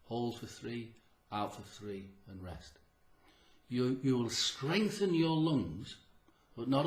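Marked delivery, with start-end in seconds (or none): 0.0-2.8 fading breathing count. Shown as a demonstration. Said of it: "make it three".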